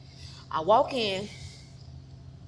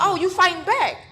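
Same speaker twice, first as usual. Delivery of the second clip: mocking taunt; 9 semitones higher, 7 dB louder than usual. Quoting attacker.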